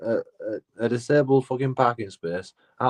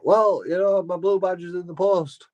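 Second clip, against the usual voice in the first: high mocking voice of a flimsy excuse; imitating a driver claiming the badge is in the post; mock lame excuse; 9 semitones higher than usual. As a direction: pitch it up and sell the lie badly.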